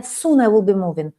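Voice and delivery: ordinary delivery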